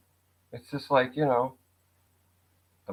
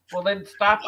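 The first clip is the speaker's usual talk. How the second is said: higher, louder retort; arguing back at critics, raised voice; mock heated retort; 8 semitones higher, 6 dB louder than usual. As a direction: snap back at them, a bit heated.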